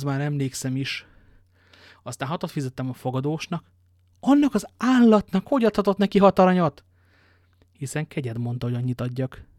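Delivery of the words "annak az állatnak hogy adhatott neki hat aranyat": loud, high outburst of outrage; indignant scolding. A narrator's reading. Master scolding his servant over the innkeeper's payment, indignant. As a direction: explode at the servant, pitched up and loud.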